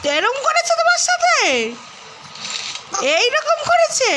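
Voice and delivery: mother hamming up shock at what papa did; mock shock. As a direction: act amazed for the baby. Mother feigning shock to baby.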